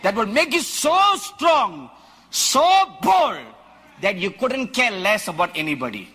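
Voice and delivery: loud, high, laughing fire-and-brimstone preaching; preaching at full force. Acted. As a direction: shout it out to the congregation, laughing with zeal.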